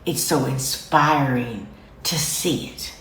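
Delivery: acted-out hushed appreciation; awed admiration. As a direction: gasp in delight, then savor it quietly. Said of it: "You should be like".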